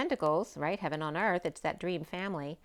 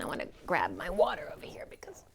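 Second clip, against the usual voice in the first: whispered aside; 4 semitones lower, 4 dB quieter, faster than usual. Real, not acted. Aside while reaching for water.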